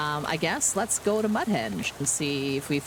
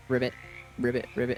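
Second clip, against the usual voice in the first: frog voices; 6 semitones lower, faster than usual. Acted. Frogs croak in chorus.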